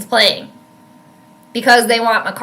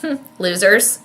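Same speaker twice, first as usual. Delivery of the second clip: smirking; 4 semitones lower, 6 dB quieter than usual. Retold or imitated. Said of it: "he was, like, smirking".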